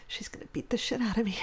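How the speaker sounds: fast whispered aside; fearful whisper. Retold or imitated. Boy dreads his sister's anger, fast whisper. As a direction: hiss it quickly under your breath. Panicked aside inside retold scene.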